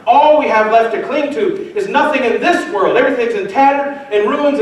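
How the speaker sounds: loud, high, building pulpit exhortation; preaching at full force. Acted. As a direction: rouse them to hold on to hope. Rallying the congregation.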